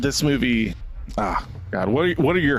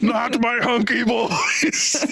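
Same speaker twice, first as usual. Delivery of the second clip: much higher, slow, wailing mock grief; mock anguish; 9 semitones higher, much slower than usual. Mimicking the dad when the magazine rips, slow high mock wail. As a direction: draw it out like a hammed-up lament. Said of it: "and he's just".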